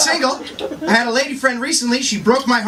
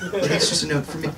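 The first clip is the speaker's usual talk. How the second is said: muttered aside; 6 semitones lower, 5 dB quieter than usual. Real, not acted. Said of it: "aside, dropped voice".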